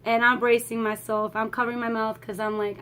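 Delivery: normal talking voice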